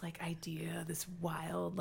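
unvoiced whisper. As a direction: breathe the words out secretly. Whispered aside in retold pitch.

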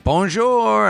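high sing-song French bonjour; French greeting. Retold or imitated. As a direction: chirp it like a shopkeeper. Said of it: "they'll be like".

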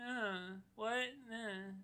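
soft mock whimper; upset doctor. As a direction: imitate a tearful, baffled doctor under the breath. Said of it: "get really upset and cry a little bit".

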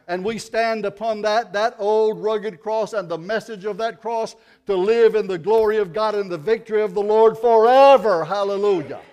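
loud, high, rising sermon cry; preaching at full force. Acted. Preacher to congregation, loud fervent preaching. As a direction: preach it out with fire.

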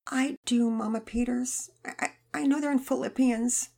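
lightly voiced girl, faltering; tearful girl. Girl near tears.

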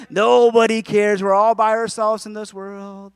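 slow, loud mock lament; negative Nelly. Preacher voicing negative emotions, drawn-out mock despair. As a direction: drag it out in self-pity. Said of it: "like a negative Nelly".

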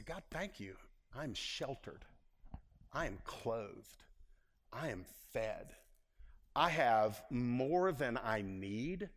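whisper-close gratitude to God; quiet thankful prayer. Retold or imitated. Preacher showing his church how he prays when giving, soft and slow. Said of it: "I just stop and I just say".

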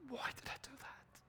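whispered regret; regretful whisper. Pretend. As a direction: whisper the question to yourself. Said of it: "and think".

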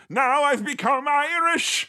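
high, drawn-out cry with an Irish tinge; hammed-up slightly Irish proclamation; twinge of Irish. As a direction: slide from heroic speech into slight Irish. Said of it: "There is a little twinge of Irish in there".

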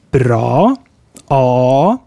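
bra, then a, with a long, dark 'aah'. Shown as a demonstration. Teacher letting learners hear the difference, long drawn-out A in bra. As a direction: hold the vowel of bra and a long and far back. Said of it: "Can you hear the difference?".

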